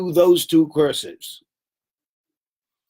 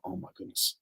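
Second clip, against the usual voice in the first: awed murmur; 7 semitones lower, 14 dB quieter than usual. Retold or imitated. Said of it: "everybody else in the class will look and go".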